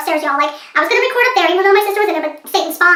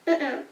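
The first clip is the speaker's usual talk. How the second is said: sighing mm-mm; 4 semitones lower, 11 dB quieter than usual. Real, not acted. Disappointed sigh at the show.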